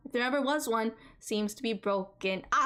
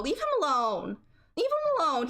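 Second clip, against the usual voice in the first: excited; 5 semitones higher than usual. Acted. Defending Cormac, high pitch.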